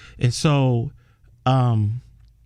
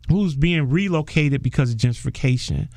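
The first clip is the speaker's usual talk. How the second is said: normal talking voice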